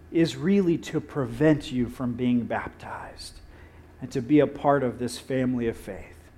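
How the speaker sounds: hushed whisper, as if thinking aloud; inner thought whispered. Acted. Preacher voicing Philip's private thought, whispered.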